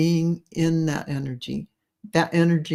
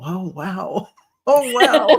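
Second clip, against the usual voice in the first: awed oh wow; 3 semitones higher, 6 dB louder, slower than usual. Pretend. Telling listeners how they'll react to a revelation, laughing in awe. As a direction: gasp at a sudden revelation, laughing. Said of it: "You're just gonna go".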